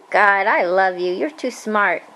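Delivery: ordinary delivery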